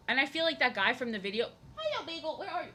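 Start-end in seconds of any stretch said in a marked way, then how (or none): 1.8-2.7 much higher sing-song call to a cat. Real, not acted. Streamer calling her hidden cat Bagel in baby talk. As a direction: coo for the cat to come out.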